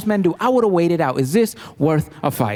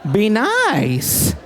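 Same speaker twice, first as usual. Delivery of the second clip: grudging mom; 4 semitones higher, much slower than usual. Retold or imitated. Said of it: "tell your mother to be nice".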